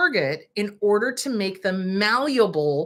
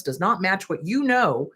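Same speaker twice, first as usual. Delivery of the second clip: usual speaking voice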